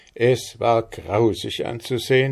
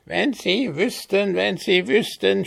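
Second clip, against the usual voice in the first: high, squawking kobold voice; squawking goblin cry; 13 semitones higher than usual. A narrator's reading. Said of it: "schrie er ... schnarrenden, quäkenden Stimme".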